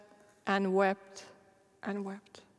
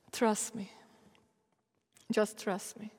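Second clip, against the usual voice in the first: softer, calm reassurance; gentle God; 3 semitones lower, 7 dB quieter than usual. Retold or imitated. God reassuring the tired preacher, soft calm voice. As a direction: quietly settle her down. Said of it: "And the Lord was like".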